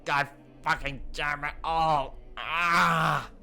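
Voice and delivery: slow curse, then a loud yell; furious shout. Real, not acted. Player yelling out his frustration. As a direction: explode at the screen.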